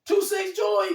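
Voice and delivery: high, loud disbelieving shout; incredulous recognition. Retold or imitated. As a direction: call out his name in astonishment. Acting out friend's startled recognition.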